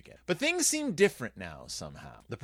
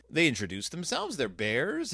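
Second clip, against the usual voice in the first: ordinary talk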